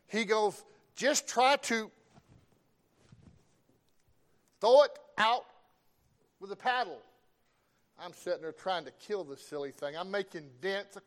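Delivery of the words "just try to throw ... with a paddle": raised, hollered instruction; grandpa yelling. Retold or imitated. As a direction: shout orders out to the boat. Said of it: "he yells".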